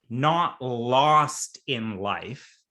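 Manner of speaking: usual speaking voice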